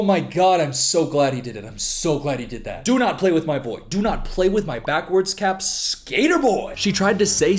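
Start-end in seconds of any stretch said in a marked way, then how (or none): none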